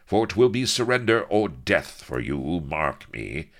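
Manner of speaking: threatening captain; stern threat. Acted. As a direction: lay down the law, hard. Avery threatens.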